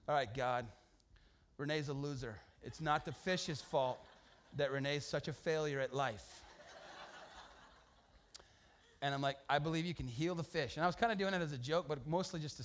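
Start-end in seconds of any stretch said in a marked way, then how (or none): 0.1-10.7 mock prayer. Mock prayer over fish.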